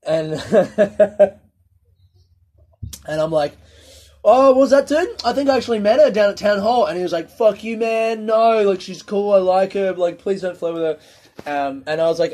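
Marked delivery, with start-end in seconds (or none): 0.4-1.3 high, loud laugh; burst of laughter. Real, not acted. Remembering Blake's bartender crush, loud laugh. 4.2-6.8 much higher, loud teasing surprise; mock surprise. Teasing housemate Blake about the bartender, high mock surprise. 7.3-11.0 higher, pleading protest; protesting friend. Retold or imitated. Blake begging his housemate not to flirt, higher pleading.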